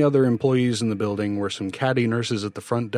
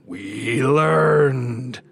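long, drawn-out louder monstrous cry; growling voices; 4 semitones higher, 6 dB louder than usual. A narrator's reading. The growing voices close in on Bill, menacing swelling cry. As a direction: swell it slowly into a menacing roar. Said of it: "deepening into growls".

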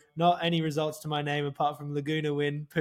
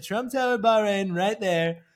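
slow, louder boastful Trump voice, pitched up; Donald Trump impression; 3 semitones higher, 4 dB louder, slower than usual. Pitching Trump Tower Bahrain to the prince, loud slow Trump boast. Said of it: "I said".

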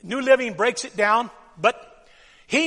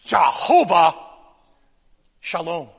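proclaimed; 7 semitones lower than usual. Shown as a demonstration. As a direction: proclaim Jehovah Shalom to the crowd.

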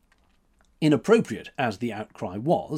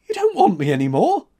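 louder, higher startled question; aghast cry; 10 semitones higher, 9 dB louder than usual. A narrator's reading. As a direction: cry out in dismay. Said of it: "he cried, aghast".

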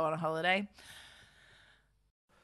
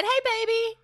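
high, put-on seductive croon; sultry come-on. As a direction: purr it like a flirt. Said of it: "I said".

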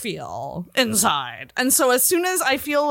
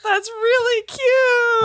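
high, slow, drawn-out squeal; 13 semitones higher, 3 dB louder, much slower than usual. Real, not acted. Gushing at her co-host's drunk boovie slip. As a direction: gush like it's adorable.

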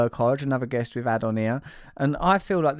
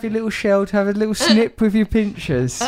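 mock teasing; 6 semitones higher than usual. Real, not acted. Teasing co-host as hermit crab.

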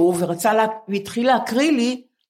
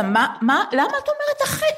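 much higher, laughing disbelief; mock astonishment; 13 semitones higher than usual. Telling Nurit off about her age, laughing mock astonishment. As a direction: squeal the question through a laugh.

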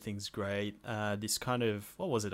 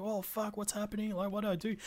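much higher, half-joking fluster; mock panic; 10 semitones higher, 4 dB quieter than usual. Bystander panicking after his mate is taken, high mock fluster. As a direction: play the helpless bystander.

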